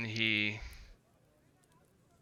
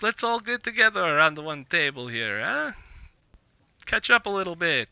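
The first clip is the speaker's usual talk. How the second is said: higher character voice with a rising "huh"; rally friends round the table, pitched up; lilting, raised voice of a host inviting company; sociable tavern host; 5 semitones higher than usual. Acted. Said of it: "And he's like".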